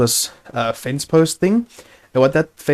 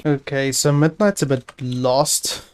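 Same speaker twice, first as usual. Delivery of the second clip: ordinary talk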